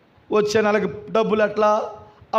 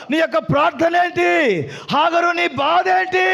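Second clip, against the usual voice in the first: full-force preaching; 7 semitones higher than usual. Real, not acted. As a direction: hurl each question at the congregation. Preacher at full force, rhetorical questions. (పూర్తి బలంతో గర్జిస్తూ ప్రసంగం)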